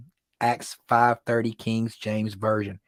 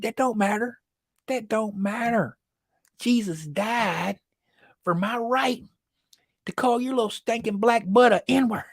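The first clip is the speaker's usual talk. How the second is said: mocking woman's voice; 10 semitones higher than usual. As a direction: imitate her pitched up, dragging each phrase. Mimicking woman's self-righteous answer.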